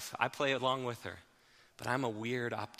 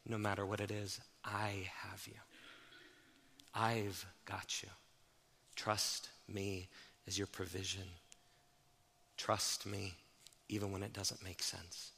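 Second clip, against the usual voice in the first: God's tender reassurance; 4 semitones lower, 11 dB quieter than usual. Acted. Preacher voicing God comforting his people, soft and tender. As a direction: lean in close and reassure them gently.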